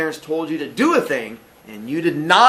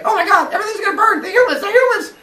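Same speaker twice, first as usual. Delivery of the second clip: high, fast, louder panic; panicked Zeus; 6 dB louder, faster than usual. Acted. Teacher voicing Zeus panicking about the humans, fast and high. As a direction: gabble it out in a mock panic. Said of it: "He's like".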